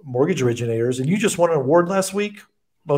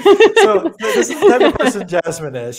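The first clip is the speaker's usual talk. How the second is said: laughing through the words, higher and louder; 12 semitones higher, 8 dB louder than usual. Real, not acted. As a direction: break into laughter while teasing your guest. Laughing while teasing the guest.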